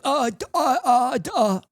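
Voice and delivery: high, stuttering mockery of a flustered opponent; mocking a guy who can't answer the argument, stammering high. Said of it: "And he's just like".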